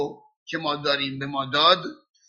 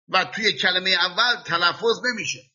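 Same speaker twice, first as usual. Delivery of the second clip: usual speaking voice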